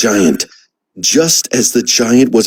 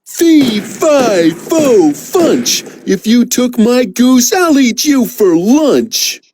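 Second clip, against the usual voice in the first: giant voice; 9 semitones higher, slower than usual. Acted. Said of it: "giant chant".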